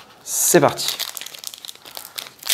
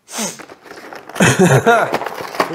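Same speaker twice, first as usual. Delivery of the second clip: rough cough, then an apology; coughing fit; 7 semitones higher than usual. Real, not acted. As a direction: cough it out hard. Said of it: "Oh, pardon, je tousse un peu".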